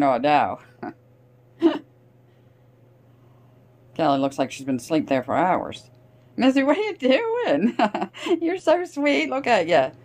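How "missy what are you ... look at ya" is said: pet talk. Real, not acted. Greeting her sleeping cat.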